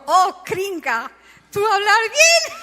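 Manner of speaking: playful imitation of a learner, high and loud; mocking broken Spanish. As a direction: tease her clumsy Spanish, drawn out. Mocks Stacy's broken Spanish.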